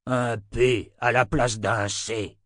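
slow, emphatic tyrant voice; deliberate villain's retort; scornful emperor. Acted. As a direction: drag out each word with contempt. Emperor scornfully repeats the misprint.

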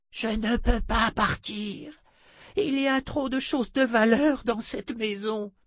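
high, slow, desperate woman; cling to your treasures; sorceress voice, pleading. Acted. Adi refuses to leave.

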